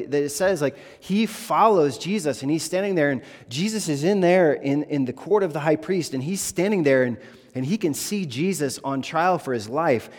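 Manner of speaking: impassioned preacher pushing the climax; full-force preaching. Acted. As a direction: preach it hard as the story climbs.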